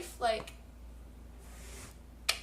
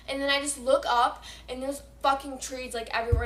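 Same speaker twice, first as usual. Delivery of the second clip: ordinary talk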